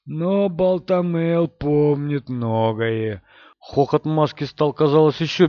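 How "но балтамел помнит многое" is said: slow, sinister ancient voice; Aginor. A narrator's reading. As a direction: speak as an ancient evil sorcerer. Aginor speaks of Balthamel.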